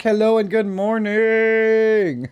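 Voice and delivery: high, slow stretched-out "hello and good morning"; drawn out like a morning show host; sing-song greeting. Real, not acted. Greeting chat viewers on stream.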